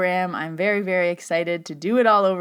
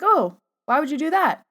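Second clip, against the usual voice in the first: imitating a baffled guy, much higher; clueless new guy; 9 semitones higher, 4 dB louder than usual. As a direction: swoop up high in mock puzzlement. Clueless new guy's question, retold.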